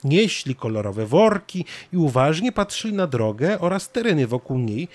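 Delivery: ordinary delivery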